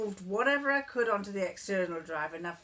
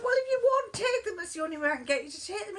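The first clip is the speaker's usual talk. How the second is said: laughing retort; 10 semitones higher, faster than usual. Retold or imitated. Her laughing reply.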